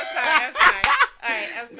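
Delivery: high, loud laughing sorry; laughing apology. Real, not acted. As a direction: apologise while laughing hard. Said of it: "Okay, okay, go ahead".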